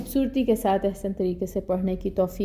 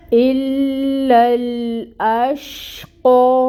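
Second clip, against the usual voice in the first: slow, higher, louder chanted الا الاشقى with a heavy قاف; Quran recitation; 5 semitones higher, 6 dB louder, much slower than usual. Shown as a demonstration. Teacher recites the phrase after the rules, melodic. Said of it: "حروف مستعلیہ میں سے ہے".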